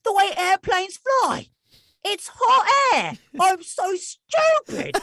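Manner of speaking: put-on very high, slow British-accented wail; exaggerated British self-reproach, slow and high; British boy impression. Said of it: "he was British, correct".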